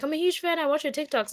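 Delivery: high, gushing fan imitation; high-pitched mock fangirl excitement; excited fan. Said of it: "they're really excited".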